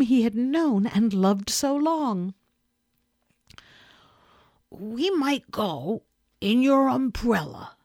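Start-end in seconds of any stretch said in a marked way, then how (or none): 4.7-7.8 slow, mildly raised bear voice; slow suggestion. Acted. Bear first suggests the umbrella, slow and measured. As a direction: offer the idea slowly, in two parts. Bear offers his idea, repeated.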